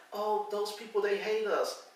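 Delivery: high, mocking imitation of her warning. Husband mocks the caller's fearful warning in a high voice. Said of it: "She was like".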